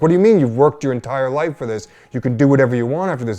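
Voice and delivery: high, loud, incredulous outburst; exasperated agent. Retold or imitated. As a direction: blast him with disbelief at the news. Agent reacts to quitting.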